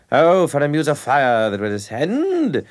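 mimicking his professor reciting Henry the Fifth, slow and grandly theatrical; imitating his Shakespeare professor. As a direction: ham it up like a pompous old Shakespeare teacher. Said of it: "Like that".

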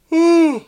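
high, loud yawning sigh; loud yawn mid-story; real yawn. Real, not acted. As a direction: let out a big high-pitched yawn. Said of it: "I had a yawn".

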